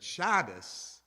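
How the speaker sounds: Shabbos drawn out, loud first syllable; slow Shabbos, stress on SHAB, ending in S. Shown as a demonstration. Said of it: "that's how they would see it in Hebrew".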